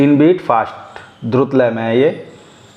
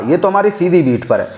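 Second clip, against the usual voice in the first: normal talking voice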